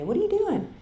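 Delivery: high, incredulous question; surprised therapist. Retold or imitated. Therapist Terry asking why she came, high and incredulous.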